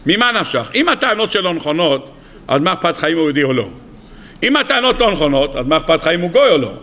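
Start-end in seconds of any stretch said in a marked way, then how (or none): none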